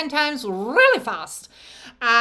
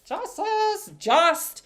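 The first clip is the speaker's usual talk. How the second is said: high playful; 8 semitones higher, much slower than usual. Shown as a demonstration. Playful emphasis on justice.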